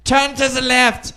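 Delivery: high, loud mock German accent calling out dance moves. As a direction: bark it like a German techno DJ. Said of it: "a German guy in the corner".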